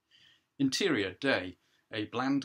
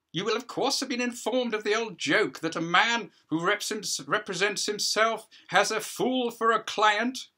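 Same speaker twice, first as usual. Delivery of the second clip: higher, louder voice of a self-important judge; judge addressing a hearing; 11 semitones higher, 7 dB louder than usual. Acted. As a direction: play the judge holding court, a pitch up and projected.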